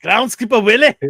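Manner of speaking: loud, high put-on cartoon accent; Groundskeeper Willie. Podcaster doing the cartoon character for a co-host, Groundskeeper Willie impression. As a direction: belt out the name in character. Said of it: "What, your accent?".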